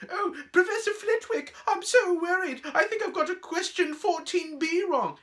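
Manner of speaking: squeaky falsetto, loud and fretful; mocking high voice. Boy teasing girl about asking her professor, high mock voice. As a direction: mimic a worried swot in falsetto. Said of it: "He put on his high voice".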